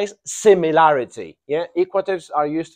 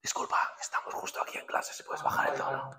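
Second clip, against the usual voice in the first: hushed unvoiced whisper; 14 dB quieter, faster than usual. Real, not acted. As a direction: whisper a request so class isn't disturbed. Asking someone to lower voice in class.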